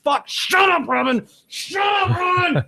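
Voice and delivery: high-pitched, exasperated yell. Retold or imitated. Viewer fed up with morning show host, yelling. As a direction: re-enact yelling at the television, voice shooting up. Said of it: "I, I yell".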